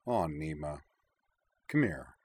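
soft, low, tender invitation; tender, hushed and low; said softly. A narrator's reading. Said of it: "said softly".

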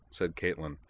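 plain reading voice

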